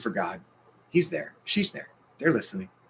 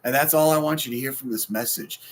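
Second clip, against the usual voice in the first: normal talking voice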